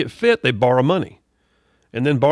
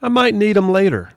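put-on high little-kid voice, casually said; pitched-up, offhand little-guy voice; nonchalant little boy; 6 semitones higher, 4 dB louder than usual. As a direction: imitate a small child being nonchalant. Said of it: "he just nonchalantly said".

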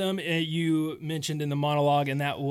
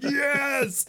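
a sudden high cheer; excited yes; 11 semitones higher than usual. Retold or imitated. Recalling his reaction to the movie, a high excited cheer. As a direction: throw out a delighted "Yes!". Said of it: "I'm like".

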